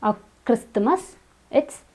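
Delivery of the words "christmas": Christmas with T and an added vowel; extra syllable after T. Shown as a demonstration. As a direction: say Christmas as KRIS-tuh-mus. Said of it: "It's not Christmas or".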